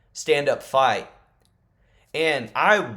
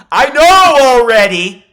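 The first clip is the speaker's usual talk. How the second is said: high, loud laughing brag; laughing boast; 11 semitones higher, 17 dB louder, slower than usual. Real, not acted. Vlogger laughing as he brags to viewers he knows the results. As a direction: laugh out that you already know the results.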